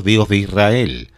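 ordinary talk

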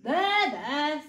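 loud, big-bear comforting; consoling bear. A narrator's reading. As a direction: soothe as a big kindly bear. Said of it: "said the bear".

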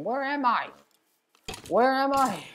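high-pitched, baffled cry; bewildered. Real, not acted. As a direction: wail it, completely lost. Lost in the game.

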